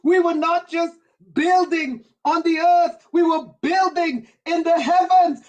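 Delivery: fervent repeated preaching build. Acted. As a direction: preach it, rising with each line. Preaching at full force.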